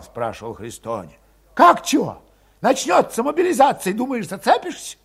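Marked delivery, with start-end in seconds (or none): 1.5-5.0 put-on high, tense young Cossack voice; high-voiced young troublemaker; Valet's voice. A narrator's reading. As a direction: pitch him up high and edgy.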